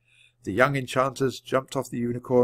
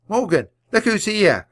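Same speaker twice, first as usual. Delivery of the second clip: high, loud eager call; 9 semitones higher, 6 dB louder than usual. A narrator's reading. Teddy calls to Morgan on the lake, excited. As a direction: call out over the water. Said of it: "called Teddy".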